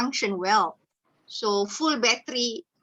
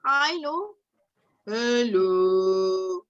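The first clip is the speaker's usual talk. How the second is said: pitch play; 3 dB louder, much slower than usual. Shown as a demonstration. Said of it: "with your pitching".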